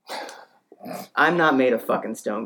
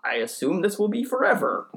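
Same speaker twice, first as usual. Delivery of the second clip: trusting pet voice with lifting, sing-song ends; puzzled dog; 3 semitones higher than usual. Acted. Dog explaining life to itself, naive. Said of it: "looks at me like".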